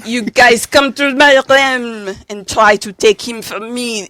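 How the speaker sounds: hammy French villain voice; a lightly accented French villain. Acted.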